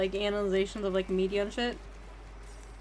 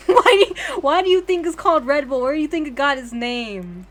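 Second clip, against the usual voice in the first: much higher and louder, laughing as she asks; laughing insistence; 4 semitones higher, 8 dB louder than usual. Real, not acted. Streamer insists to chat about Red Bull, laughing and loud. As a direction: push the question through real laughter.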